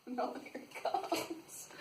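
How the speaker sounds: plain reading voice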